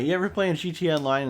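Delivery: ordinary talk, nothing marked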